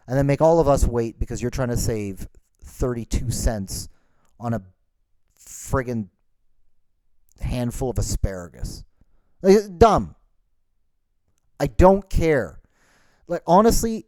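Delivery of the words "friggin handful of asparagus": ordinary talk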